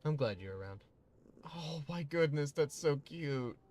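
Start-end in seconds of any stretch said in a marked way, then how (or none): 1.4-3.6 higher, louder, slow gushing. Real, not acted. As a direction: melt over how cute it is. Gushing over the cat.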